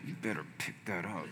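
very low, barely audible threat; hushed coach warning. Retold or imitated. Coach to a struggling lifter about to quit, hushed warning. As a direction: imitate a coach warning under his breath. Imitating coach leaning in, "did this.

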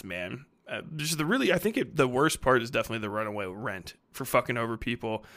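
ordinary talk, nothing marked

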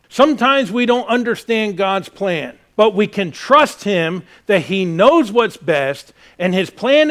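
full-force preaching. Acted. Pastor urging the congregation to trust God, loud preaching.

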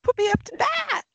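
high cheery announcement; chirpy. Retold or imitated. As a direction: bounce in all eager. Retold cheerful return to team.

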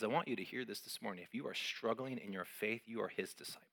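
ordinary delivery

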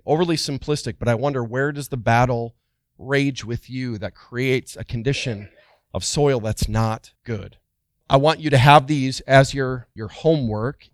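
ordinary talk

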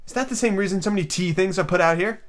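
lightly voiced girl; curious Alice.

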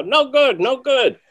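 high, loud, curt repeated dismissal; mentor's "no good" imitated high and loud; imitating mentor's verdict. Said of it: "And he'd go".

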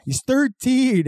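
hammed-up elf repeating the age; mock anguished elf. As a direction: stretch it out as if about to cry.

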